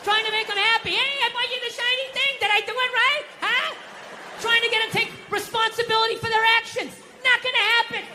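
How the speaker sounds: high-pitched, hopeless venting; exasperated rant. Acted. Husband venting about pleasing women, high exasperated rant. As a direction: rant up high as if at wit's end.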